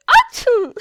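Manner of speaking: huge high sneeze; loud sneeze. Acted. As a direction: blast out a big sneeze. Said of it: "Hilary sneezed again".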